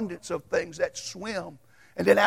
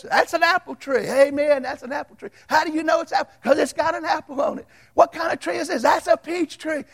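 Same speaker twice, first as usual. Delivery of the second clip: put-on high excited kids' voices, louder; kids answering; 7 semitones higher, 4 dB louder than usual. Little kids shouting answers to the teacher. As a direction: chirp the answers like eager kids.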